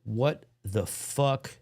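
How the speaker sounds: usual speaking voice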